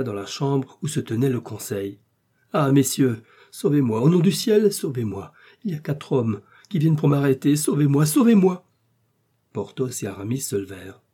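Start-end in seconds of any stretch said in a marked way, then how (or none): 2.5-8.7 loud, higher cries for help; desperate pleading, surging at the end; panicked plea. A narrator's reading. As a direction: beg urgently to be rescued. Hunted mercer bursts in begging rescue.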